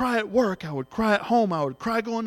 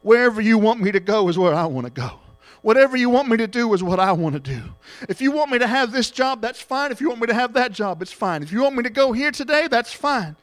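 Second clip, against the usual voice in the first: loud, earnest surrender to God; fervent prayer; 3 semitones higher, 4 dB louder than usual. Real, not acted. Preacher praying aloud before the church. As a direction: pray it out with full conviction.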